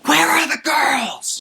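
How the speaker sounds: put-on high, slow shout of where are the girls; mock-dramatic yell; shouted line. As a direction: belt it out like a reenactment. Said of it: "it's a shame it's so late here".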